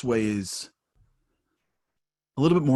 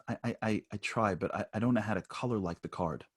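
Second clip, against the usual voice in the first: put-on much softer, stammering little boy; lightly voiced sheepish four-year-old, faltering; sheepish child; 7 dB quieter than usual. Son stammering to his father that he can't color, much softer. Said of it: "he's like, sheepish".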